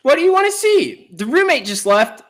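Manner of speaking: high falsetto woman's voice; lady voice. Acted. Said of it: "do your best lady voice".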